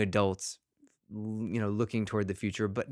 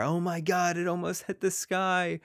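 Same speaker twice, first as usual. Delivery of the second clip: put-on awed kid; 9 semitones higher than usual. Kids amazed at a thrown ball.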